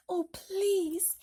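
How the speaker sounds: put-on high, pleading little boy; begging. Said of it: "he had begged".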